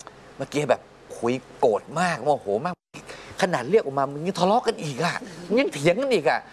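rising, animated half-amused rant; venting to wife. Retold or imitated. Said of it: "แล้วก็บอกพี่บุ๋ม".

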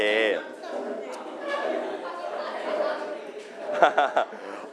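long low chuckle; laugh. Real, not acted. Teacher to class before the magic trick, laughing. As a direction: laugh to yourself. Laughing during warm-up chat.

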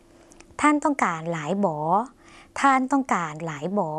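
slow, careful Lao question "ท่านต้องการหลายบอ"; slow Lao demo. Shown as a demonstration. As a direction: say "ท่านต้องการหลายบอ" slowly in Lao, drawing out each syllable. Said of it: "ไปต่อเลยค่ะ".

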